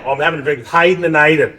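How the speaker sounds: a light imitation of an Aussie teen; broad Australian. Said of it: "back in Australia, mate".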